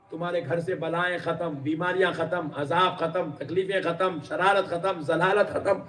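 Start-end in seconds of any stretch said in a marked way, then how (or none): none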